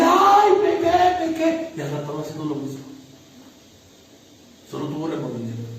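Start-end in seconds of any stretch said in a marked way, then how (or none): 0.0-1.7 mock remorseful wail. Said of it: "Llora y dice".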